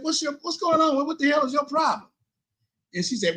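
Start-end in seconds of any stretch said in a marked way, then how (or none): 0.0-2.1 high-pitched retold demand for an explanation; exasperated confrontation. Calling ex-wife back to ask what's wrong, exasperated. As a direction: challenge her, pitched well up.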